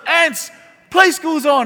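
drawn-out call from the next room; sister's yell. Retold or imitated. Sister calling her brother to the TV, a slow loud call. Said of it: "And she yells".

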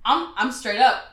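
ordinary delivery

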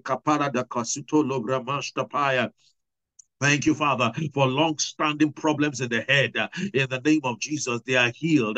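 fervent, rising prayer of thanks; healing prayer. Real, not acted. Preacher leading thanks for healed bodies, fervent. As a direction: pray with building fervour.

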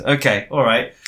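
usual speaking voice

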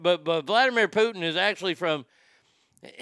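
ordinary talk, nothing marked

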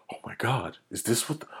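hushed, breathy imitation of shock; shocked whisper. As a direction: whisper it like a stunned viewer. Acting out shocked first-time viewers.

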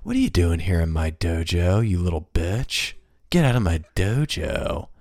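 lightly low Seagal growl; Steven Seagal. Retold or imitated. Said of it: "like a Steven Seagal".

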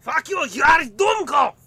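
high, shouted mock German insult. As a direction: yell it at full force. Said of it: "Wrong evil empire".

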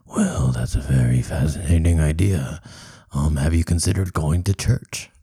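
low, dry, skeptical voice; deep doctor voice. Acted. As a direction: ask it low and deadpan. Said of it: "doing both voices".

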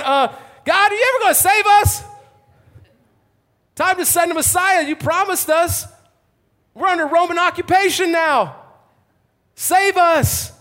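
loud, high-pitched demand to God; desperate loud plea. Acted. Worshippers begging God for a promised Messiah, loud desperate plea. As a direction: cry out as if fed up with waiting. People plead under occupation.

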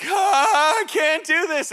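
high, loud mock wail of a scared kid; panicked child. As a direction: cry out like a child stuck up high. Scared son on monkey bars.

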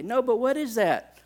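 put-on high child voice pressing the question; insistent child. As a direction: play a kid who won't let it go. Daughter insisting.

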